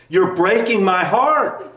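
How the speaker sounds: put-on slow, loud, laughing child's cry; mock child yell. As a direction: belt it out like a wailing kid, half laughing. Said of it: "He yells out at the top of his lungs".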